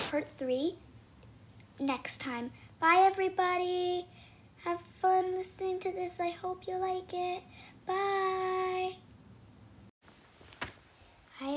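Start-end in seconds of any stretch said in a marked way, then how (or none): 2.8-9.0 high, loud singsong sign-off; singsong goodbye. Real, not acted. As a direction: chant a cheery farewell to listeners. Signing off to listeners.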